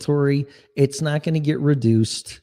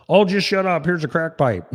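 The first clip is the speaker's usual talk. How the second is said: higher, scoffing mock voice; mock dismissive official; 6 semitones higher than usual. Host voicing the system scoffing at strikers, mocking. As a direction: brush them off like a smug boss.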